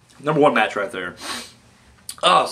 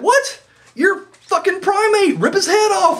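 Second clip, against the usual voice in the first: much higher, shouting in disbelief; outraged yell; slower than usual. Retold or imitated. Wrestling fan screaming at his hero to fight back, higher. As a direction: yell at the screen like a fan cheated of a beatdown. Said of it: "And I was like".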